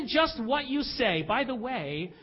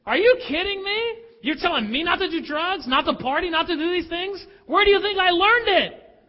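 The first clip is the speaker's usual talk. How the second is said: put-on incredulous teen; 6 semitones higher than usual. Teenage son confronting his drug-using parents, outraged disbelief. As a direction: sound stunned and indignant. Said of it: "I'm sitting there thinking".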